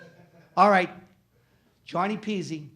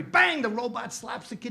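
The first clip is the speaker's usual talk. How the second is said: normal talking voice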